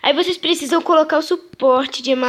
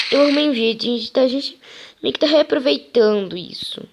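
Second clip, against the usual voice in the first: ordinary delivery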